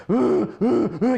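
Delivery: high, loud "euh" grunts imitating a bear; mama bear calls. Grandson mimics mama bear calling his grandfather back, high bear grunts. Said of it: "comme la maman ours ... qui faisait".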